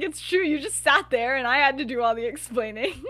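loud, insistent retold complaint; mock indignation. [name] complaining to James, mock indignant.

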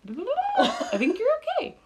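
high squeaky teasing voice; mock high voice. Teasing mock reply, laughing.

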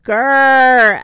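a loud growling grrr; monster growl. Acted. Narrator growls to scare young listeners.

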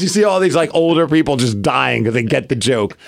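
ordinary delivery, nothing marked

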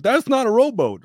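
high, loud imitated Russian accent. Ship's chief eyeing the life raft, incredulous Russian accent. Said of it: "like, a Russian accent".

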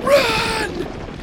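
very high, loud scream to flee; urgent shriek over noise; yell. A narrator's reading. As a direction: yell it in alarm. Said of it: "yelled Sawyer".

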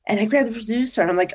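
ordinary talk

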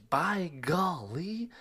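high, slow drawn-out by golly; drawn-out exclamation. Acted. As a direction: stretch it out in mock wonder. Exclaiming about social life.

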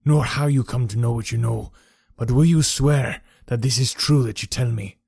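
a hint of a bitter, agitated old man; lightly voiced old soldier, pressing hard; understated gruff veteran demanding the truth; crooked old soldier. A narrator's reading. Henry Wood, bitter crooked man.

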